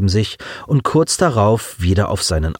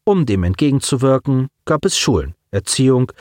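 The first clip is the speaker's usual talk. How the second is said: ordinary delivery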